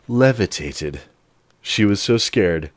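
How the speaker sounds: usual speaking voice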